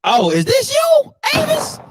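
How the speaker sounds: very high, incredulous squeal; high-pitched, ribbing question; mocking disbelief. Real, not acted. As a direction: soar up high in teasing disbelief. Mocking another picture of Avis.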